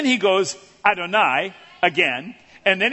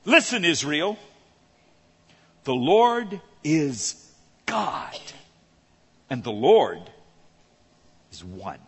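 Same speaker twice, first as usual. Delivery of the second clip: solemn reading; 5 semitones lower, slower than usual. Shown as a demonstration. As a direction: read the scripture line with hushed reverence. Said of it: "here's the direct translation".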